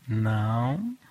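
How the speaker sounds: a low, soft but firm no. Shown as a demonstration. Parent refusing a child, low and firm. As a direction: say no calmly with no shouting. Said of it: "mas você falou firme".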